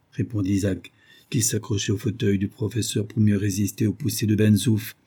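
ordinary delivery

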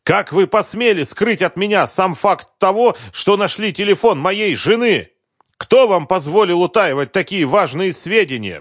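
high, loud enraged tirade; furious shouting. Acted. Husband berating the investigator for hiding evidence, furious shout. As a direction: tear into him with outrage. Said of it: "Гневный крик Концевича".

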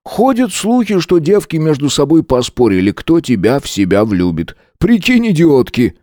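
high, lively schoolboy gossip voice; одноклассник. A narrator's reading. (высокий голос болтливого одноклассника)

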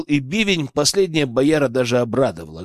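plain reading voice

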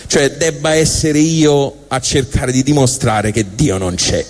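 ordinary talk, nothing marked

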